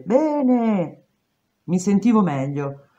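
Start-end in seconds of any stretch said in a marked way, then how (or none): 0.0-1.0 distant voices through a wall; muffled chorus. A narrator's reading. Inmates answering through the wall, muffled. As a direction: answer from far behind metal. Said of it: "un coro di voci smorzate".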